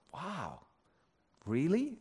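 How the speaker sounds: hushed, wondering inner voice; a retold thought, softly taken aback; quiet surprise. Dave thinks over his father's comment, quietly surprised. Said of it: "he was surprised by the comment".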